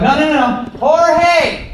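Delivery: high, calling across the club for Jorge. Retold or imitated. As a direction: yell for the guy with the mop.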